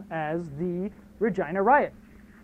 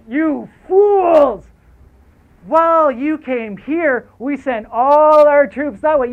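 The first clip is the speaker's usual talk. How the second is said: higher, louder, slow mocking yell; gloating villain shout; 7 semitones higher, 9 dB louder, slower than usual. The Prime Minister taunting the strikers, slow loud gloating.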